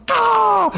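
high, drawn-out mock scream; frustrated scream. Preacher just off the phone, frustrated mock scream. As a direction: let out an exasperated high wail.

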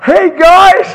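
high, loud delighted shout; excited greeting. Real, not acted. Preacher spots family friends mid-sermon, excited shout. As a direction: call out to friends you've just spotted.

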